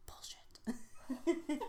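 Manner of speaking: breathy giggling whisper; giggly prank whisper. Acted. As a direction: whisper it with a laugh. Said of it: "So sensual".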